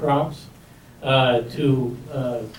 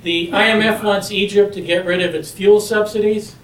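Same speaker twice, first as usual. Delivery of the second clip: loud, higher drill-sergeant projection; Marine voice; 5 semitones higher, 4 dB louder than usual. Shown as a demonstration. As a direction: boom it out like a Marine addressing troops. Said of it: "use my Marine voice".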